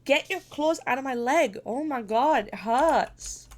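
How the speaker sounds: real pained outcry, pitch swooping up; pained complaint. Real, not acted. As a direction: wail at the cat to let go. Cat's claws in her leg.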